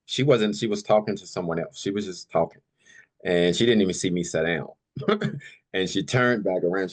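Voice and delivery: ordinary talk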